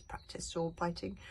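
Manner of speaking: usual speaking voice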